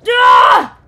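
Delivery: a short, loud startled scream. Real, not acted. Streamer scared by the game, loud startled scream. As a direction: yelp as if jumped at.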